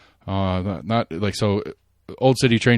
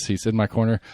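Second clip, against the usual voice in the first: normal talking voice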